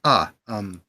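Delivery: startled, hesitant exclamation; taken aback. Acted. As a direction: caught off guard, fumble for words. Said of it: "his eyes go wide".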